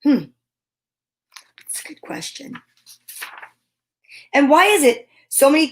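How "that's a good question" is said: hushed aside; whisper. Real, not acted. Reader whispers a reply to the book's question. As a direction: whisper it thoughtfully.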